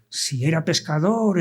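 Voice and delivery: usual speaking voice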